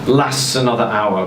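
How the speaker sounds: ordinary delivery, nothing marked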